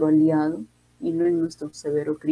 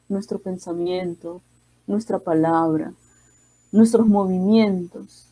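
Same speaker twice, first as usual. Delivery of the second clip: ordinary delivery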